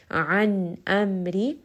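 An amri very slow, pausing and resting on the nun and mim; resting on letters. Shown as a demonstration. Teacher demonstrating tawassut, very slow with rests. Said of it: "you will take a moment to pause on this letter and rest on it".

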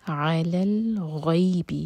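الغیب slow, the یـ glide drawn out; madd leen. Shown as a demonstration. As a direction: stretch the ay of الغیب gently. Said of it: "مد لین".

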